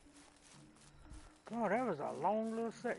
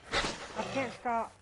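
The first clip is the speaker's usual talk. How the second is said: panicked; 6 semitones higher, 5 dB louder, slower than usual. Real, not acted. Running from wolf.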